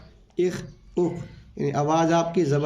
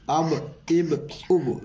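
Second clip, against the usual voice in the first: अब इब उब drawn out very slowly, each vowel clear; slow joined syllables; much slower than usual. Shown as a demonstration. Teacher showing pupils how to join letters, slow and clear. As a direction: spell अब इब उब slowly for the learner. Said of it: "तो इसको कैसे पढ़ेंगे".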